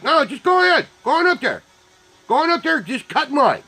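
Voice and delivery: high-pitched exasperated mock permission; high, fed-up urging; sarcastic go-ahead. Retold sarcastic waving of line-jumpers on.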